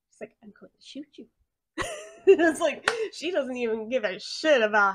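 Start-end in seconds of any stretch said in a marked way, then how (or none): 0.4-1.3 very soft, cold, flat-out promise; deadpan threat. Retold or imitated. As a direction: say it almost under your breath. Calm deadly threat.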